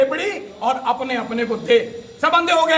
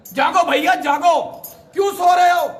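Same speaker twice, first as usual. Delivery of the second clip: loud, raised rousing call; calling out to wake; 4 semitones higher, 4 dB louder than usual. Acted. Protester to sleeping highway officials, calling them to wake up.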